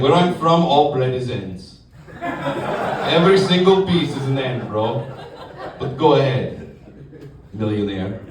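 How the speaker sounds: put-on slow, heavy Russian accent; scornful Russian-accented grumble; Russian coworker. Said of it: "I work with a guy from Russia".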